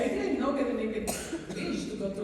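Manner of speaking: mock retort. Retold own retort.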